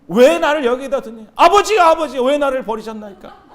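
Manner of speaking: loud, high, hammed-up wail to father; mock lament. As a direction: plead like an abandoned son, overdone. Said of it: "통곡을 하는 거야".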